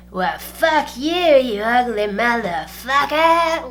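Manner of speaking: put-on slow, loud, sloppy drunk slur; drunk woman's insult. Drunk stranger cursing at the boyfriend, slow and slurred. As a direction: drag it out like a wasted woman at the bar. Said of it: "And she goes".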